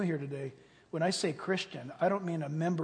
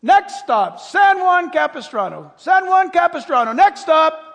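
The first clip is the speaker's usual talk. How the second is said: loud, higher conductor call; train conductor; 9 semitones higher, 16 dB louder than usual. Retold or imitated. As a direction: call the stops down the aisle. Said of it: "He said".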